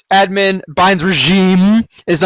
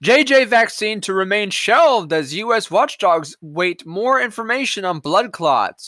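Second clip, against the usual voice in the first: ordinary talk, nothing marked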